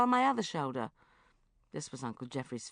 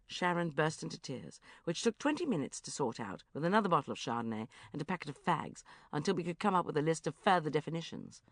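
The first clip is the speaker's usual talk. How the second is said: ordinary delivery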